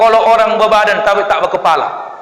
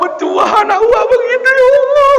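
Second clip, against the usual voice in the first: put-on high, excited voice gushing over the children; gushing admiration; 4 dB louder than usual. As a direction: rave like a thrilled neighbour.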